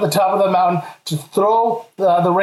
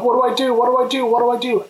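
higher-pitched mock panic, repeating urgently; retold panic; 5 semitones higher, faster than usual. Climber with no cell signal, panicking. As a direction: replay a helpless panic, pitched up. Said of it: "And I start panicking".

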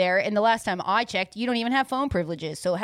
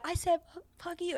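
hushed, higher-pitched imitation; mad friend; 7 semitones higher, 10 dB quieter than usual. Friend repeating her curse when challenged, softly mock-mad. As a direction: retell the curse small and very soft. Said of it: "so mad at me".

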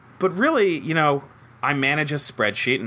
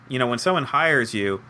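ordinary delivery

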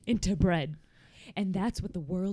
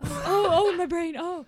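high startled gasps; 9 semitones higher, 6 dB louder than usual. Acted. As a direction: jolt at a voice inside. Startled by voice in brain.

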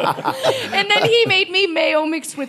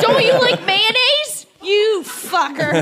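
mock outrage; 6 semitones higher than usual. Mock outrage over honey dill.

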